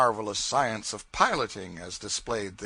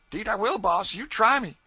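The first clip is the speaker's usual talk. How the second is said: higher, louder earnest pleading; eager promise; 10 semitones higher, 6 dB louder than usual. Acted. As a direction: beg to be let off.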